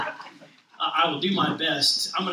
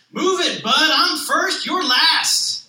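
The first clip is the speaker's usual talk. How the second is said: higher, louder, slower bossy shove; pushy truck; 8 semitones higher, 8 dB louder, slower than usual. Acted. Grocery truck shoving past Little Blue, loud and bossy.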